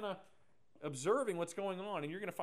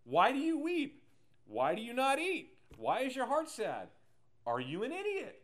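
much higher and louder exasperated questions; mock insensitive husband; 8 semitones higher, 6 dB louder than usual. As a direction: hammer each question like she's being silly. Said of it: "he's insensitive".

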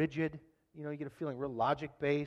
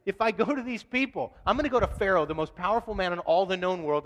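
louder, higher incredulous objection; protesting Moses; 4 semitones higher, 6 dB louder than usual. Retold or imitated. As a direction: act out an overwhelmed man arguing back. Voices Moses protesting to God.

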